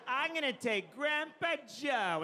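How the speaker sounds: high, slow, drawn-out taunt; mocking spiteful kid. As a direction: flaunt the choice in a mock-smug boy's voice. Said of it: "kinda gives the finger to the other three".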